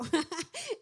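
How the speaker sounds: quick high bright laugh; a burst of real laughter mid-sentence; short laugh. Real, not acted. Risa en medio del relato. (risa breve y aguda)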